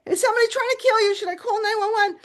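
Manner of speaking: high, rushed worried questions; alarmed doctor. Retold or imitated. Doctor asking patient if someone is killing her, alarmed and high. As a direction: fire off the questions urgently.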